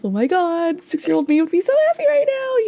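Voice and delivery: high-pitched happy gush; excited self-talk. Retold or imitated. Telling herself after something great happens, excited. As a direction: gush in a high, elated squeal. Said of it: "I always tell myself".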